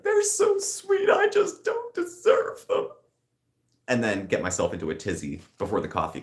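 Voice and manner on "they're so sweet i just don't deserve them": high, slow, put-on voice of a weepy cat lover; teary cat-mom squeak. Talking to her cats on a crying day, high and slow. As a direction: gush over the cats in a tiny high voice. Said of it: "look over at the cats and be like".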